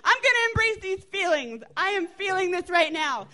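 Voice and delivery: high, loud mock tantrum. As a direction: raise your voice like a worked-up child. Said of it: "it just kept getting louder and louder".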